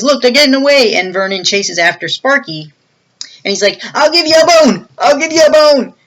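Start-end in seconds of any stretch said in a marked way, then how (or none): 0.0-1.0 higher, louder alarm; alarmed call. Retold or imitated. Henchman alerts partner of escape, raised alarm. 3.9-5.9 high, loud hammed-up yell; calling after dog. Thief chases runaway dog, hammed-up shout.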